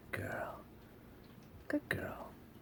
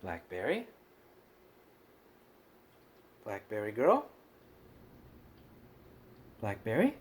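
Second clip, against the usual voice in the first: soft, lower calling with rising end; 6 semitones lower, 6 dB quieter than usual. Real, not acted. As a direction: coax the cat to come. Calling the cat softly.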